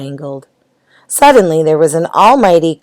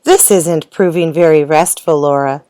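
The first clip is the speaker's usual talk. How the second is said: usual speaking voice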